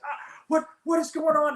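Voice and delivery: flustered new guy. Retold or imitated. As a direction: play the overwhelmed rookie, voice up high. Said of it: "The guy's like".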